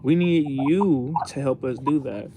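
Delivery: ordinary delivery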